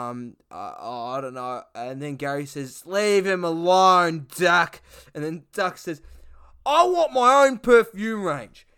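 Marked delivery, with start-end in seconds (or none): 2.8-4.8 slow, louder mock scold; stern Gary. Gary telling Duck off, slow loud scold. Gary voiced in retold sketch. 6.7-8.7 whiny Duck. Retold or imitated. Duck complaining about Shane Warne, high loud whinge. Duck voiced in retold sketch.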